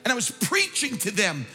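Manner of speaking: loud, high, driving preacher's cry; fervent full-force sermon delivery; preaching at full force. Acted. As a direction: preach it out to the whole room, climbing.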